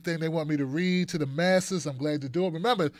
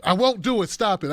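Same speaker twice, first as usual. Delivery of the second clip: usual speaking voice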